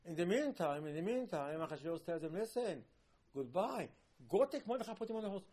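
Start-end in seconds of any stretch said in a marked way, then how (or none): 2.3-5.4 raised, louder voice of command; dismissive royal order. Retold or imitated. King dismisses his visitor and orders Mordechai honored, commanding. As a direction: send him off like a king.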